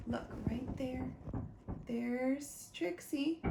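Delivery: ordinary delivery, nothing marked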